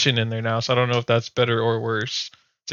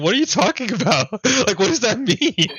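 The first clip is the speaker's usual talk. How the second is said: confused; 9 semitones higher than usual. Retold or imitated. Confused retelling of intern's joke.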